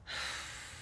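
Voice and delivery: soft, breathed-out sigh. Real, not acted. Reader pausing mid-thought with a soft sigh. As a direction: let out a quiet breath. Pauses while searching for words.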